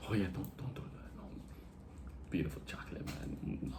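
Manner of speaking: whispered and low, hushed warning. Retold or imitated. Hotel clerk warning him about the name, whispered low. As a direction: hiss it like a secret nobody should hear. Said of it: "She's like".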